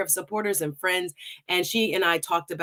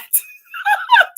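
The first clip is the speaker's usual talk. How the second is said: laugh; 9 dB louder than usual. Real, not acted. Bursts out laughing at the gifts.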